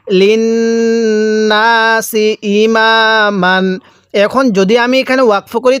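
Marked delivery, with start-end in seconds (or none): 0.1-3.8 drawn-out chanted recitation; slow Quran recitation. Shown as a demonstration. Teacher reciting slowly for students to repeat. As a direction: recite slowly, stretching each vowel. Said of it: "কিভাবে পড়ব?".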